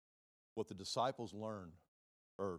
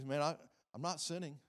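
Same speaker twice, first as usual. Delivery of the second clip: whiny self-excusing sinner voice; mock defensive sinner; 5 semitones higher, 3 dB louder than usual. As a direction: play a sinner excusing himself. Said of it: "You, you".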